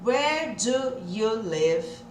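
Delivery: very slow, word by word with gaps; each word said apart, dragged out; separado, devagarzinho. Shown as a demonstration. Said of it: "Se você falar tudo devagarzinho". (bem devagar, palavra por palavra)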